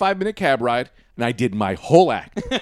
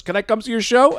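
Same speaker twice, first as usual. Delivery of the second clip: high, hopeful ask; eager fan; 10 semitones higher, 5 dB louder than usual. Retold or imitated. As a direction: ask like a cheeky freeloader. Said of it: "he goes".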